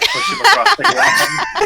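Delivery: words broken by laughter; giggling while describing; laughing. Real, not acted.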